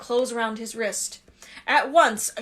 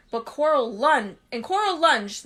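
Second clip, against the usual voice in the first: plain reading voice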